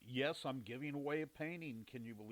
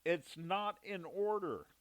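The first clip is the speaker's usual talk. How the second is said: higher, louder, slow yelled call from the other room; imitated yell; 8 semitones higher, 7 dB louder, slower than usual. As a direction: shout it like the wife calling out from afar. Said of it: "yells out".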